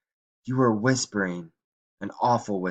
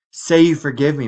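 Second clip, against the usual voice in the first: higher, louder insistent plea; urgent pleading; 6 semitones higher, 8 dB louder than usual. A narrator's reading. Grandmother begging Mike to forgive her, urgent pleading.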